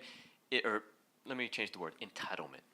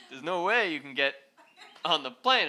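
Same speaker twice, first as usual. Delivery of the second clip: quoted line broken by real laughter, louder; can't stop laughing while retelling; giggling out the agent's words; laughing through the quote; 7 semitones higher, 10 dB louder than usual. Real, not acted.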